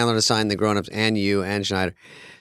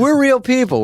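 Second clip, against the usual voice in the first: much higher, louder protest; emphatic insistence; 11 semitones higher, 4 dB louder than usual. Real, not acted. Defending themselves to listeners, emphatic loud protest. Insisting fans love them.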